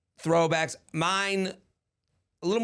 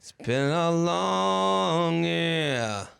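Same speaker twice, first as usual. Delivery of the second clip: slow, stretched-out delivery; drawn out; much slower than usual. Acted. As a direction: stretch every word out slowly. Drawn-out echo of a line.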